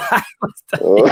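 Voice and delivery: cracking up while talking; words breaking into helpless laughter; real laughter. Real, not acted. Friends amused by a streamer's rant, real laughter.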